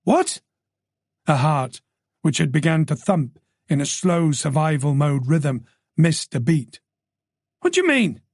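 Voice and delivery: frightened detective; alarmed Tess. Acted. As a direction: panic about her parents. Tess voiced by narrator.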